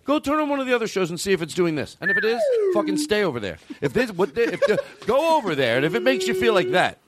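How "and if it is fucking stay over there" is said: much higher hammed-up rant; fake-angry send-off to listeners; mock outburst. Host telling bored listeners to leave, hammed-up rant.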